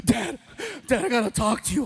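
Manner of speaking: mock devastated, in a rush; devastated son. Son coming home to dad, devastated. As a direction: imitate an upset teen blurting it out. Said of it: "he's all devastated".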